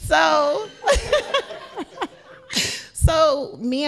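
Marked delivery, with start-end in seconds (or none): 0.1-2.1 high loud cackling laugh; high laughter. Real, not acted. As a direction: crack up for real. Laughing fit after joke.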